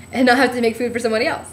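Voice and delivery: normal talking voice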